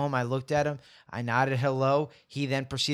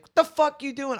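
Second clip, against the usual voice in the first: dad yelling up; 12 semitones higher, 3 dB louder than usual. Retold or imitated. Dad spots son on roof.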